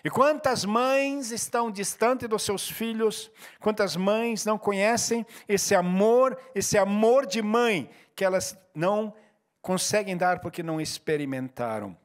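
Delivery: slow, drawn-out pastoral appeal; slow, earnest appeal to mothers in the church; slow heartfelt appeal. Acted. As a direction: stretch every word, pleading gently.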